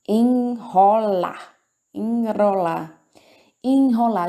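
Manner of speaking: "Enrolar" drawn out, the R a heavy throat sound; strong R. Shown as a demonstration. Teacher showing class the heavy R, slow and deliberate. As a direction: stretch "Enrolar" slowly, leaning on the R. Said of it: "Só que é o R com som forte".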